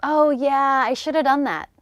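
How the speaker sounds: shoulda run together as SHOOD-uh; casual shoulda in a sentence; reduced shoulda. Shown as a demonstration. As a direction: drop the have in shoulda. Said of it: "What's it mean?".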